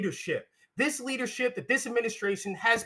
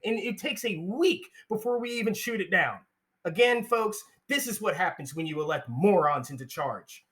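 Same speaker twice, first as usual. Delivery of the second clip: ordinary talk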